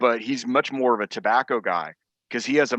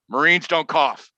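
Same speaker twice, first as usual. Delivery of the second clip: lightly voiced drill-sergeant order, raised and louder; mock sergeant major; 5 semitones higher, 5 dB louder than usual. Sergeant major to coughing Marines, imitated as a raised mock command. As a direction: lay down the rule like a sergeant major.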